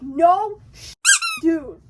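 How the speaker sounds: loud, cut-off curse; exasperated outburst. Retold or imitated. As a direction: blurt it out, fed up. Brody answers mayor.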